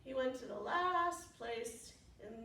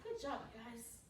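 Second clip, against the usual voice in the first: usual speaking voice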